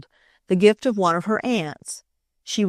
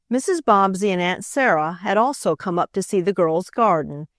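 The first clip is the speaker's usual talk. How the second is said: usual speaking voice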